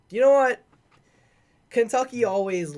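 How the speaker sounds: spring the idea on them; sudden excitement. Real, not acted. Sudden change of mind on pick.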